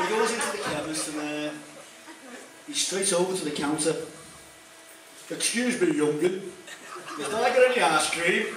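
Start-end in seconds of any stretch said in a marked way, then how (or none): 5.3-8.6 put-on Yorkshire accent. Yorkshire lad at chemist counter.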